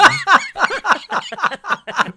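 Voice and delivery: loud high laugh; big burst of laughter. Real, not acted. Laughing at own Buffy story.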